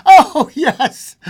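high, loud eager cry; quivering excitement. A narrator's reading. Wife urging her husband on, quivering with excitement. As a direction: burst out excitedly. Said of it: "quivering with excitement".

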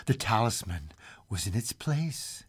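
plain reading voice

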